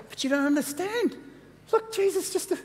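high-pitched, flustered protest; mock protesting. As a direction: act out a flustered disciple objecting. Disciples protesting to the angels.

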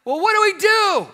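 high, loud call from the crowd; a heckler's desperate shouted question; yelled question. Retold or imitated. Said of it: "somebody yells out".

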